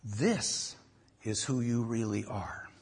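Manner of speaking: calm, quiet and clear; soft, measured calm truth. Retold or imitated. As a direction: lay it down softly and steadily. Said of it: "very clearly, very calmly".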